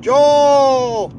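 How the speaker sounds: high, loud drawn-out holler for Joel. Retold or imitated. Imitating a patient hollering for Joel, high loud holler. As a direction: call the name across the ward at full voice. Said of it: "keeps hollering".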